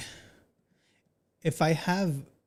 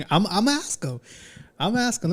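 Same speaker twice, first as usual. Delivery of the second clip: laughing, higher, fast; jokey laughing threat to call; 8 semitones higher, faster than usual. Real, not acted. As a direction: giggle through it, teasing. Laughing, joking he'll phone.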